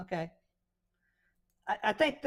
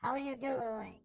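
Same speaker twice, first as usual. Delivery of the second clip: husky female filter; 7 dB quieter than usual. Shown as a demonstration. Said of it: "This is husky female".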